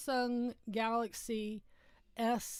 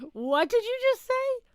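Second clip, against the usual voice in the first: much higher, louder stunned demand; shocked outburst; 13 semitones higher, 10 dB louder than usual. Retold or imitated. Mother to son who just swore, shocked and loud. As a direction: shriek the question in disbelief.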